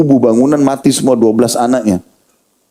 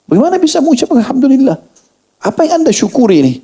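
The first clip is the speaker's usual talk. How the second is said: astonished questioning; 14 semitones higher than usual. Retold or imitated. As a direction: ask as if you can't believe your ears. Astonished man questions grieving father.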